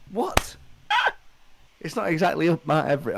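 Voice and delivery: usual speaking voice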